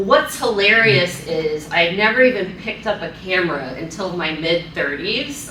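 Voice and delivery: normal talking voice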